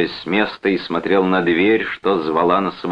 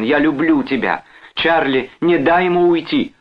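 high, urgent appeal; pleading; 10 semitones higher, 4 dB louder than usual. A narrator's reading. Wife implores husband and brother, pleading. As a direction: implore both men desperately. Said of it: "взмолилась Джон".

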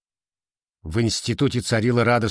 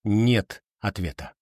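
ordinary delivery, nothing marked

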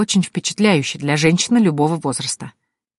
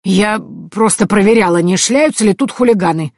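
higher-voiced grumbling granny; peevish old crone, pitched up; grumbling old woman; 4 semitones higher, 4 dB louder than usual. A narrator's reading. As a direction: grumble defensively, caught snooping. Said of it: "проворчала бабка".

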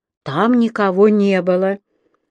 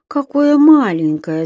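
put-on higher, slow childlike wondering; musing; 6 semitones higher, 4 dB louder, slower than usual. A narrator's reading. Еля thinking about the tiny monster, musing. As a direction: wonder aloud, drawing it out. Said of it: "задумалась Еля".

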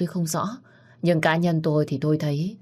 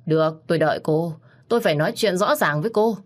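plain reading voice

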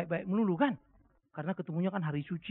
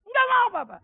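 high, loud whiny shout of refusal; child's refusal; 9 dB louder than usual. Retold or imitated. Small child refusing to eat vegetables, high whiny shout. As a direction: whine like a stubborn little kid.

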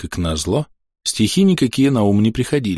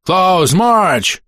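high military call in English; shouted command; 10 semitones higher, 4 dB louder than usual. Acted. British officer to advancing troops, shouted command. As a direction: call out a marching order. Officer's order in battle story.

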